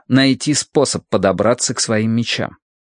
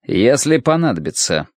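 usual speaking voice

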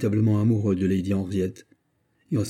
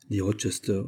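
ordinary delivery

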